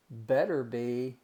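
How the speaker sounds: ordinary talk, nothing marked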